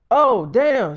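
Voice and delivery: high, loud oh no; a man realizing he forgot his sister's visit; sudden realization. Retold or imitated. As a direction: caught off guard, blurt it.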